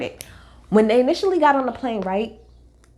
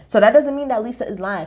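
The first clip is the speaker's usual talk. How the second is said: ordinary talk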